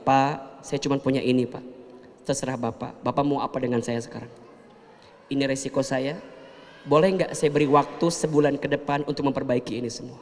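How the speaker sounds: mild calm humble request; calm, soft speech. Shown as a demonstration. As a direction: speak calmly, humbly, without rising. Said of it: "lebih tenang bicaranya".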